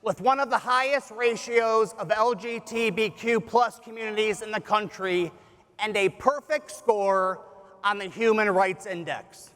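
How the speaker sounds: normal talking voice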